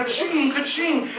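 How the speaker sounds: mock register chime; playful cash register noise; cash register ka-ching. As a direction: ring it out like a till.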